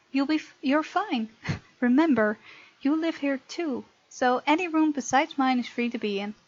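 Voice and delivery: ordinary delivery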